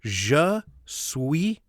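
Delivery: Sparks trying French again, halting American French. Acted. Sparks tries his French again.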